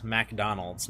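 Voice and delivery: "McDonald's" with a full MAC at the front; Mac pronunciation. Shown as a demonstration. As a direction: say "McDonald's" as mack-DON-alds. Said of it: "Not McDonald's, but MacDonald".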